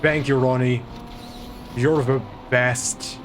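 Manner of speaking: ordinary talk, nothing marked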